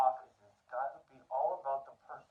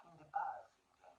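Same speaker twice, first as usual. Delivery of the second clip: hushed; 10 dB quieter than usual. Real, not acted. Trailing into a hushed aside.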